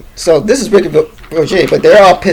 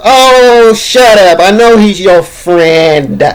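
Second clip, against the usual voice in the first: louder, drawn-out mock rebuke; mock scolding; 3 semitones higher, 4 dB louder, slower than usual. Host to the groaning audience, imitated loud scolding. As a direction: brush off the groaners, stretching the words.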